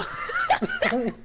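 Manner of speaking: squealing laugh; high laugh. Real, not acted. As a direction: crack up. Laughs at quote.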